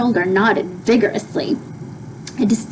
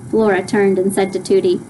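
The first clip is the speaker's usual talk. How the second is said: ordinary delivery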